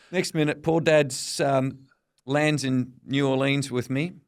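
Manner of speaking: normal talking voice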